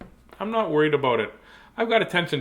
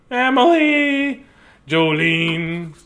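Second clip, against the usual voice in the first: being silly; 2 semitones higher, 6 dB louder, much slower than usual. Pretend. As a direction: stretch each name out in silly fun. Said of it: "That was me being silly".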